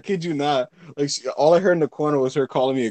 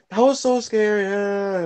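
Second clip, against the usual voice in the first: higher-pitched mock girlish whine; imitated silly girls; 6 semitones higher, slower than usual. Mimicking girls fresh out of a scary movie, imitated gushing.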